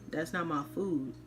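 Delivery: ordinary talk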